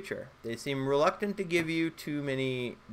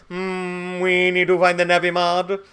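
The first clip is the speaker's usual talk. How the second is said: a loud, solemn, put-on alien; Galaxy Quest alien voice; 5 semitones higher, 8 dB louder than usual. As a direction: intone it like a stiff sci-fi envoy. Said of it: "he talks like, uh, that dude from Galaxy Quest".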